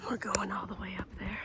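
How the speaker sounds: soft unvoiced whisper. Real, not acted. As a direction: whisper it to yourself. Hushed aside before the climb.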